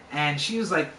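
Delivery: ordinary delivery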